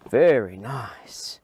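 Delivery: whispered slow praise to the dog. Real, not acted. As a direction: breathe the praise, don't voice it.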